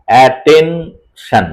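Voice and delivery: "অ্যাটেনশন" very slowly as a, ten, shan; syllable split. Shown as a demonstration. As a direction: separate each syllable of "অ্যাটেনশন" with a gap. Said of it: "ইংরেজি সিলেবলের মতো".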